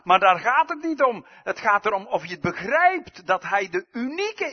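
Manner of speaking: loud, rising, insistent sermon voice; preacher building to a loud climax; full-force preaching. Acted. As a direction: hammer the point home from the pulpit.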